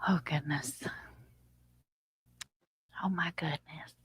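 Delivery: soft unvoiced whisper. Real, not acted. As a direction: hush it under the breath. Whispered exclaim.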